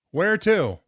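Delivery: loud, high shouted question; calling out. A narrator's reading. Plow driver asking travelers their destination, calling out. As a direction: call it down from the cab.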